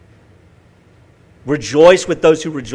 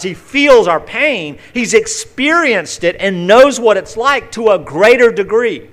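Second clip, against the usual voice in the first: louder and higher, fervent pulpit voice; full-force preaching; 6 semitones higher, 6 dB louder than usual. Acted. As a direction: proclaim it to the congregation.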